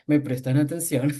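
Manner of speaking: exaggerated deep put-on voice; super-low voice. Shown as a demonstration. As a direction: drop far down, hamming up a low register. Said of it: "hablar súper grave".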